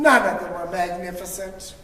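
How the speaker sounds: usual speaking voice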